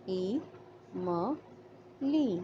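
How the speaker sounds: ई म ली split into separate syllables with pauses. Shown as a demonstration. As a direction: break इमली into ई, म, ली. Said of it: "इमली". (इमली को अक्षर-अक्षर तोड़कर बोलना)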